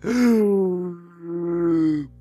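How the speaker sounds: winded loud gasp; big loud winded gasp; loud gasp for air. Retold or imitated. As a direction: gasp hard like the wind was knocked out. Knocked breathless landing on floor.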